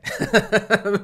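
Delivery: high, loud laugh; real laugh at a bossy grandma. Real, not acted. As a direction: burst out laughing.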